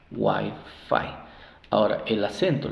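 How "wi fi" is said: "Wi-Fi" split into "wai" and "fai"; syllable by syllable. Shown as a demonstration. Teacher sounding out the word, Wi-Fi syllable by syllable. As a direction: say "Wi-Fi" as two separate long-I syllables. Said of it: "Entonces suena guay".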